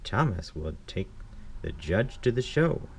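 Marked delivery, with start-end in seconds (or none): none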